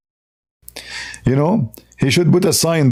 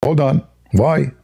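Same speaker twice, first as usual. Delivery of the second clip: ordinary delivery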